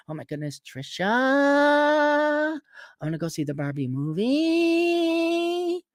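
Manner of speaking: hammed-up girl squealing about Barbie; mock basic girl. Mocking basic girls going to see Barbie, breathy high gush. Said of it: "Do your stupid women talk like that in Canada?".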